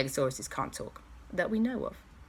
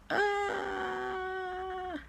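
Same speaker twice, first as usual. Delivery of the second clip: high softer yelp; cry out; 7 dB quieter than usual. Acted. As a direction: yelp as you fall. Villain lands in tree.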